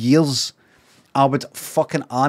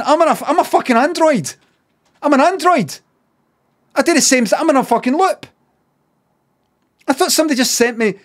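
high-pitched disbelief; freaked out; 8 semitones higher, faster than usual. Real, not acted. Streamer realising he repeats himself, freaked out. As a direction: exclaim it like a man discovering he's a robot.

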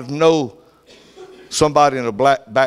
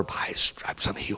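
low, much softer whispered claim; weak whisper; 8 semitones lower, 11 dB quieter than usual. Retold or imitated. The sick mother whispering faith against cancer. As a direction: breathe it out from a sickbed. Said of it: "She's speaking his executive order".

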